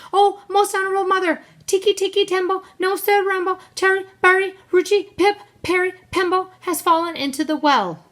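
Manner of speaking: high, loud, rushed plea to mother; frantic little boy raising the alarm; urgent child. A narrator's reading. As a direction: blurt out the emergency, high and fast. Child urgently runs to tell mother.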